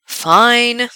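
weary, put-upon "fine"; mock dramatic sigh. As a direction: give in with a theatrical sigh. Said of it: "Gerard sighs dramatically, put upon".

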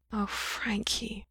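slow, whispered sorrow; hushed, sorrowful tenderness; very sad. A narrator's reading. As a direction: sigh the name softly, full of sadness. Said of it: "he'd never sounded so sad".

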